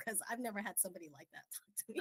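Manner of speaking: quick, hushed aside under the breath; whispered aside. Real, not acted. A speaker whispering a quick aside to the others.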